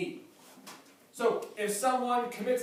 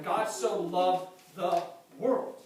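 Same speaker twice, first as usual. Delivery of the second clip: slow verse; 3 semitones lower, slower than usual. Acted. Slowed repeat of verse.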